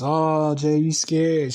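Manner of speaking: taunting. Retold or imitated. Landlord egging on her son Jay, taunting.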